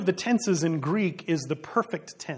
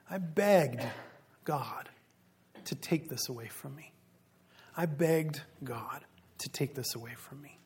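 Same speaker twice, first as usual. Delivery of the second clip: soft, low, pleading as Paul; a quiet, begging prayer; hushed pleading; 3 semitones lower, 6 dB quieter than usual. Acted. Preacher voicing Paul begging God, hushed and low.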